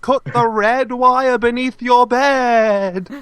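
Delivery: high, slow, drawn-out warning; hammed-up time-travel message; voice from the past. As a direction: intone it as a dire warning from the past. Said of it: "speaking to you from the past".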